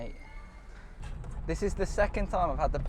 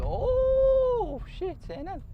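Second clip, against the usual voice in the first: startled oh; much slower than usual. Real, not acted. Startled while parking.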